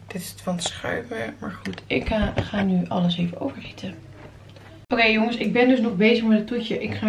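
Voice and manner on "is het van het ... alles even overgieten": softly whispered aside. Real, not acted. To viewers while pouring the dessert, whispered. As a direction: murmur it without voice while pouring.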